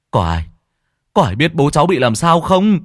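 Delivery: crying out. Acted. Son calling to villagers about his father, loud desperate cry. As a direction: shout for help over the body. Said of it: "Anh Nghĩa gào lên".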